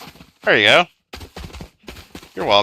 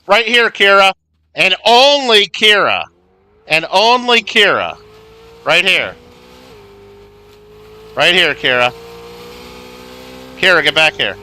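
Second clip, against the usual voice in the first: calling out to player; 4 semitones higher, 4 dB louder than usual. Real, not acted. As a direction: call her over, higher at first.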